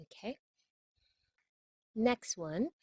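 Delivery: ordinary delivery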